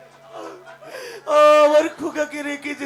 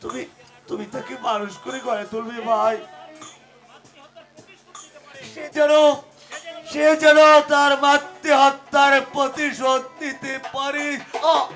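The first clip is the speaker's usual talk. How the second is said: dying, weak voice. Acted. Dying man entrusts his child.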